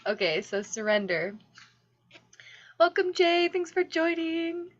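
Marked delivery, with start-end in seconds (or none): 2.8-4.7 sing-song greeting. Real, not acted. High greeting to newcomer.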